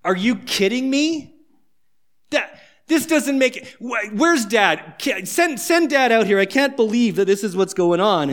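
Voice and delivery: put-on older son fuming at the party; indignant older son. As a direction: demand to see dad, fuming. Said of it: "the older son's going".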